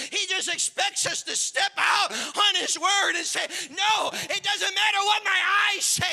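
high, loud, building pulpit shout; rally the congregation at the top of your voice; full-force preaching. Real, not acted.

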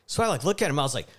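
ordinary talk

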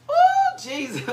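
ooh flung up high, then Jesus; a spirit-filled cry soaring up; high ecstatic ooh. Real, not acted. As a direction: falsetto praise cry. Preacher overcome mid-sermon.

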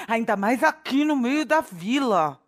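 ordinary delivery

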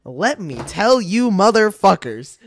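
loud, slow, drawn-out opener; mock loud declaration. Loud slow opener answering the racism question. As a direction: boom it out like a hype man.

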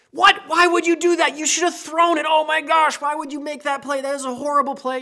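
high, loud yelling at the TV; outraged fan yelling. Acted. Husband watching Seahawks game as wife talks, outraged yelling. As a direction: explode in hammed-up outrage at a bad play.

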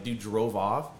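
ordinary talk, nothing marked

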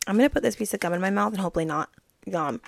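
ordinary delivery

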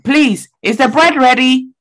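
Ghanaian accent. Shown as a demonstration. Asking grandma if the bread is ready, Ghanaian accent. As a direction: put on a deliberate Ghanaian accent to be understood. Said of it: "I put on a Ghanaian accent".

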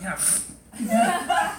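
slow, laughing fake grudge; mock reluctance. Accepting the blessing, slow laughing mock reluctance. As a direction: drag it out like it's a chore. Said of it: "You're like".

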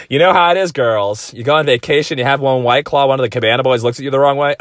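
lightly voiced sassy gal pal; gossipy girlfriend voice. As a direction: dish to your girls. Addressing "girls" gossip voice.

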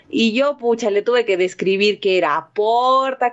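normal talking voice

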